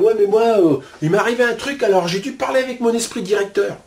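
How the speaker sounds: high, loud mock bragging; mocking boastful quote. Smug seeker bragging about talking to spirit, mocked. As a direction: mimic a smug seeker showing off. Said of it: "quant à dire".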